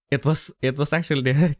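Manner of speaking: ordinary delivery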